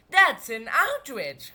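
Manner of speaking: loud, slow, indignant outcry; outraged cry. A narrator's reading. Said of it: "cried Nelly".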